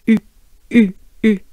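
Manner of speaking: short, separate French "U" with dropped jaw and lips; droopy-face French U. Shown as a demonstration. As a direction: model the French "U" as isolated bursts. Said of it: "It should sound like this".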